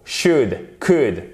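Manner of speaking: "should, could" with short vowels and silent L. Shown as a demonstration. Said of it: "une voyelle courte".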